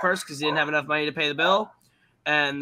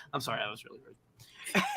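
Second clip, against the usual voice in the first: low, soft mock apology; imitating a meek apology; sheepish apology; 5 semitones lower, 11 dB quieter than usual. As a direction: mumble a small, contrite sorry.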